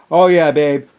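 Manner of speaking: loud, leering lover voice; mock seducer. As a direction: play a sleazy seducer. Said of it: "He goes".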